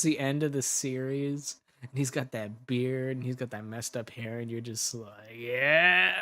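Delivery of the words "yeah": a sudden loud, thrilled "Yeah!"; excited yell. Retold or imitated. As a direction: shout it out, swept away by the look. Swooning over Jace's look.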